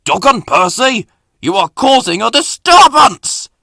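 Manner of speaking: loud, high, angry telling-off; thundering. A narrator's reading. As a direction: thunder at them like a furious boss. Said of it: "thundered the Fat Controller".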